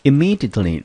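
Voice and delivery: "Immediately" said high and loud, each syllable clear; model pronunciation. Shown as a demonstration. Teacher presenting "Immediately" to class, high and loud. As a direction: announce "Immediately" clearly as a headword. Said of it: "补充一个词类，immediately".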